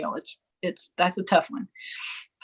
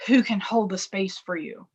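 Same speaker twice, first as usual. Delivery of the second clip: usual speaking voice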